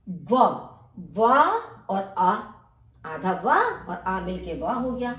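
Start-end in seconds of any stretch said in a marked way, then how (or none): none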